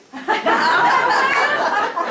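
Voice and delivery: high, loud burst of laughter; big laugh. Real, not acted. Teasing the room about the couple's wedding night, bursting into laughter.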